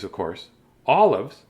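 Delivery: plain reading voice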